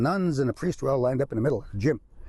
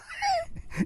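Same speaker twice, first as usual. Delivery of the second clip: high burst of laughter; 4 dB quieter than usual. Real, not acted. Speaker laughs at own joke.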